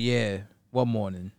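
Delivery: low, slow grumbled reply; bitter New Yorker. Acted. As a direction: answer sourly, soft and dragging. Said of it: "a very bitter New Yorker over here".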